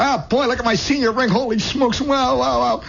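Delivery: put-on high boyish gushing; hammed-up high excited boy; excited kid. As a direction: crow like a kid showing off. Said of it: "Now I'm a kid again".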